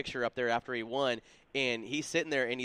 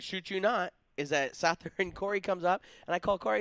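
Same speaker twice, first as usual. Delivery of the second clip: ordinary talk, nothing marked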